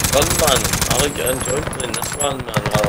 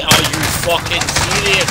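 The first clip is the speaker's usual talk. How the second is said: loud, higher, fed-up disbelief; exasperated outburst; 6 semitones higher, 6 dB louder, slower than usual. Real, not acted. Player fed up with getting killed, loud exasperated outburst. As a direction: bark it at the screen in disbelief.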